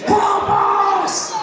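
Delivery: shouted high plea for help; desperate cry. Retold or imitated. Pleading to God, shouting high for help. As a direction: cry out to be rescued.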